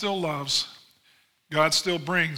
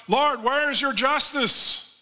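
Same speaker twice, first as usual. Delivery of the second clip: an anguished plea, voice raised high; crying out; 8 semitones higher, slower than usual. Acted. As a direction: cry out to God in desperate, drawn-out pleading. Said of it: "the people were crying out".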